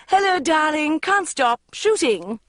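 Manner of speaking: high, sing-song trill. A narrator's reading. Mother rushing past her daughter, trilling high. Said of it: "trilled my mother".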